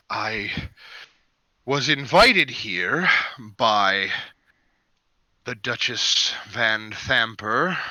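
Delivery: lightly voiced tired nobleman, slow and low; weary overseer. Overseer explaining his capture to the party, tired and low.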